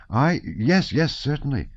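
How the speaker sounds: eager blushing cry. A narrator's reading. Boy accepting a dangerous mission, eager crying out. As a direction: jump at the chance, nearly in tears. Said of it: "cried Petya, blushing almost to tears".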